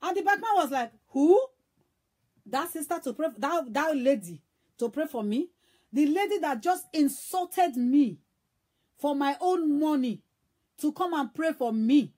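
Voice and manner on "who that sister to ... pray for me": sharp loud "who", then affronted protest; indignant disbelief. Retold or imitated. Insulted bike man refuses the sister's prayer, indignant. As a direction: act out an insulted man's outrage, leaning hard on words.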